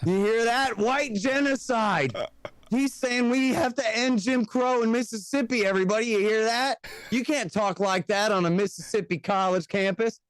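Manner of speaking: mock alarm, like rallying a mob against a heretic; mock outraged segregationist.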